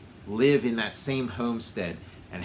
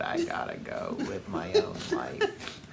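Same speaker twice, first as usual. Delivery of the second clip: high whispery falsetto; high mock whisper; 5 dB quieter than usual. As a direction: breathe it in a tiny high voice.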